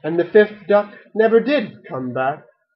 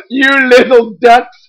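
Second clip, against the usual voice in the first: loud, higher amused accomplice; chuckling policeman; 6 semitones higher, 8 dB louder than usual. A narrator's reading. Policeman accomplice to the prankster ducks, chuckling. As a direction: tease them indulgently, high and loud. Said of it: "chuckled to himself".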